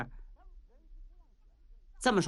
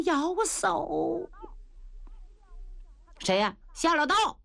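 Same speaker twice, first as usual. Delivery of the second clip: ordinary delivery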